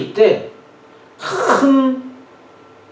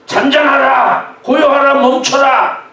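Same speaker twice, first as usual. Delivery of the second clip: high, shouted string of orders; commanding, rebuking shout; loud command; 7 semitones higher, 4 dB louder than usual. Acted. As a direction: bellow at the storm to stop, even harder. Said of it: "큰 소리로 명령하듯이".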